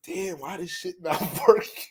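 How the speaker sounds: badly faked frustration with a laugh; mock frustration. Shown as a demonstration. Showing how to psych a Virgo, fake frustration. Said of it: "You just gotta act mad frustrated".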